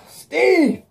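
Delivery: high loud yell of the name; sharp high shout. Retold or imitated. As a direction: yell Steve's name in alarm. Eddie shouts at Steve.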